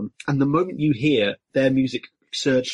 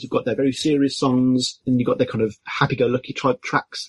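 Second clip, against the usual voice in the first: usual speaking voice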